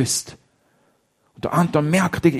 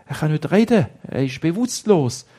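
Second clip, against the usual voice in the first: ordinary delivery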